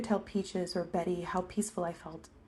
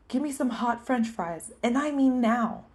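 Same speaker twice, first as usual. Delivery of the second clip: louder, higher order, slowing on the last words; urgent demand; 5 semitones higher, 6 dB louder than usual. A narrator's reading. Waiter demanding hot fries from the cook, urgent raised voice. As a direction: demand it forcefully, leaning on NOW.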